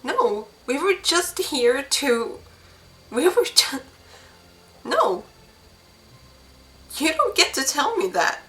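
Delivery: high, rising despair; distraught denial. Acted. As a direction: refuse the news, voice climbing. Widow refusing the news.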